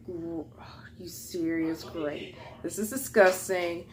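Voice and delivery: slow, breathy, hushed disbelief; whispery mock despair; retold disgusted groan. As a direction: groan it under your breath, fed up. Retold disgusted reaction to spill.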